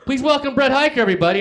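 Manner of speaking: high, loud announcer call; emcee introduction. Retold or imitated. Host brings the comedian on stage, emcee introduction. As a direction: announce the act to the room.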